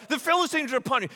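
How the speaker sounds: half-hearted yell of warning, rushed; mock yell. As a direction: call out a fake alarm fast. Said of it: "and yells".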